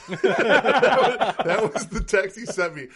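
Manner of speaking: cracking up telling it; laughing. Real, not acted. Telling friends about the text, laughing through it.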